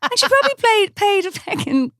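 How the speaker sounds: high, worked-up protest for someone else; indignant outrage. Retold or imitated. Stylist defending a let-down bride, high indignant outrage.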